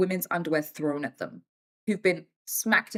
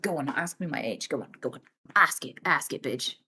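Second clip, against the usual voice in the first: lower, softer taunting dare; teasing dare; 5 semitones lower, 4 dB quieter than usual. Retold or imitated. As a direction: goad them playfully. Mom daring people to ask.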